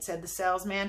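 plain reading voice

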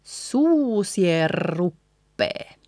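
very slow suusie ruppe with long rolled R; drawn-out linked suusie ruppe, no N; doubled consonant. Shown as a demonstration. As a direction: stretch suusie into the trilled R of ruppe. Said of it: "doubling the consonant".

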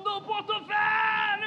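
slow, stretched goal yell; drawn-out goal shout. Real, not acted. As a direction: hold the club name long.

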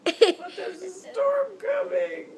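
fake hurt pouting. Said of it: "totally a fake cry".

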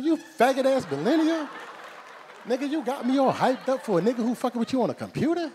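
high-pitched, loud outraged rant; furious, worked up. Retold or imitated. Uncle scolding nephew over an online bully, furious and loud. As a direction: yell in comic outrage, climbing to a peak. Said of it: "I'm so fucking mad".

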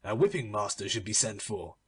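a bored uncle giving up on the dwarf; wearily. Acted. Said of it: "said Don Pedro wearily".

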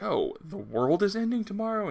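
high, rising incredulous question; mock disbelief. Retelling Moira learning of the world's end, mock disbelief. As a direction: swoop up high in mock astonishment. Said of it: "Moira's like".

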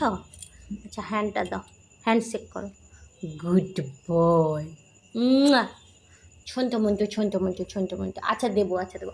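low, soft coaxing; soothing pet talk. Real, not acted. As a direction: soothe a sulking dog, low and gentle. Soothing the sulking dog. (আদর করে নিচু গলায়)

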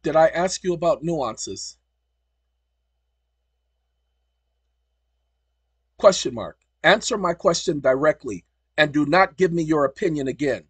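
loud, angry telling-off of the chatbot; angry scolding. Real, not acted. Scolding the assistant.

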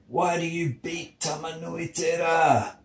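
high, drawn plaintive voice; acted moan, pitched high. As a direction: wail the question as the suffering sun. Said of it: "he moaned".

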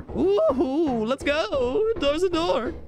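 high, bright whoop of joy; excited cheer. Real, not acted. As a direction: cheer it out, thrilled to find a door. Finds a door, cheers.

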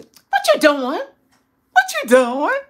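lightly voiced rising questioning husband; mock the husband catching her; imitating her husband asking what she's doing; mimicking husband.

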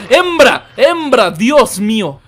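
high, loud repeated cries; hammed-up calling. Streamer demands a woman from the game, mock-desperate shout. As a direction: call out, pleading at volume.

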